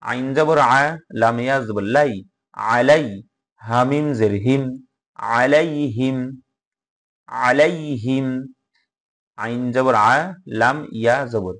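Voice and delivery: measured, intoned letter-by-letter recitation; teacher's Arabic recitation for learners to follow; Quran spelling drill. Shown as a demonstration. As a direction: spell out each syllable, then join it in a lilting chant.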